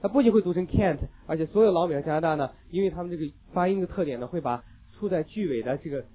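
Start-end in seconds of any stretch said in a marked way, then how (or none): none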